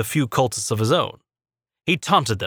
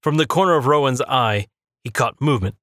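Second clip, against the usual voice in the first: plain reading voice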